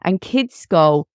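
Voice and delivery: ordinary delivery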